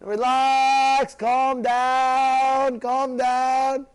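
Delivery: loud, drawn-out plea to calm down; loud calming call. Acted. Acted reply to the shouter.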